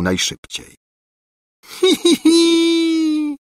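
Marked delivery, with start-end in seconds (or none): 1.6-3.4 very high, drawn-out hee-hee; screechy cackle. A narrator's reading. Governess screeching after the fleeing prince, shrieky cackle. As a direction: screech out a witchy wail. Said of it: "skrzeczała za nim Gburja Furia".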